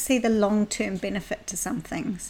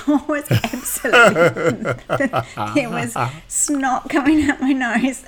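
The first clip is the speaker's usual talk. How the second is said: laughing while retelling crying; 3 semitones higher, 6 dB louder, slower than usual. Retold or imitated. Crying on the massage table.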